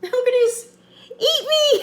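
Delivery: high, loud shriek through laughter; laughing shriek. Real, not acted. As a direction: squeal it out, cracking up. Shrieking at the video.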